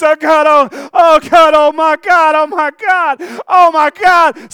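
high, shouted acted crying out; overwhelmed cry. Preacher acting the healed woman, overwhelmed cry. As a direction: wail it loud, overcome by the touch.

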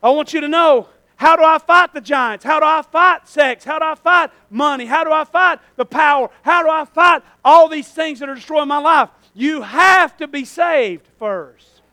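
loud, insistent pulpit questions; forceful preaching. Real, not acted. Preacher asking how to fight the giants, forceful preaching. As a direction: press each question on the congregation.

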